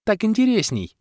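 very high, smiling female character voice; cheerful. A narrator's reading. Sorceress justifying her choice, cheerful. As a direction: say it with glee. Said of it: "радостно улыбнулась волшебница".

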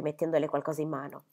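usual speaking voice

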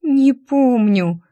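woeful stretched wail; plaintive moan. A narrator's reading. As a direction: moan it slowly and pitifully. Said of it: "И жалобно простонала".

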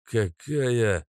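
mumbling. Acted. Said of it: "начал мямлить Каша".

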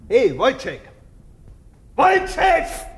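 a doctor calling for his subject. Acted. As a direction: summon him sharply by name.

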